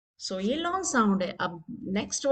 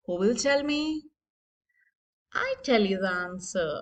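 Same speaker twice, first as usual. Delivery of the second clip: ordinary talk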